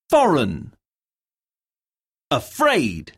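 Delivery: each word said alone, stressed syllable clearly hit; slow, careful citation words with a clear stressed syllable. Shown as a demonstration. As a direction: model the stress for the class to repeat. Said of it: "Words have stress on different syllables".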